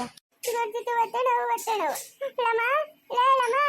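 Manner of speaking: high, pained wail; plaintive, high-pitched pleading; labour pain. Acted. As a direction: call out for help through the pain. Labour pain, calling for help.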